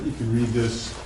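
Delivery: ordinary talk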